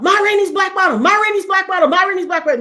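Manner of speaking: loud, high repeated shout; screaming out. Retold or imitated. Mother mopping the house, screaming the words out loud. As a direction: scream the title out three times. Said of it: "I just scream out".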